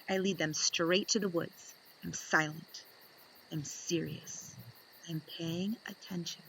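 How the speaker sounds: usual speaking voice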